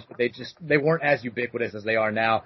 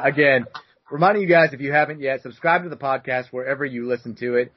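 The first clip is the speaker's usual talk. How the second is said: ordinary delivery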